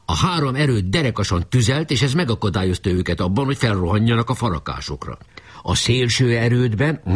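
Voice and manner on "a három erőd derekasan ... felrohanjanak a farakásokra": plain reading voice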